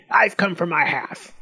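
a hint of gloating goblin; grinning goblin. A narrator's reading. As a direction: claim it with a sly grin. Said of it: "he grinned".

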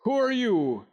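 hearty, friendly enquiry; gruff, friendly. A narrator's reading. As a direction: ask the children kindly but heartily. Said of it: "in his gruff, friendly voice".

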